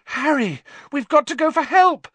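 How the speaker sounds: high, loud panicked cry. A narrator's reading. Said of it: "Hermione cried".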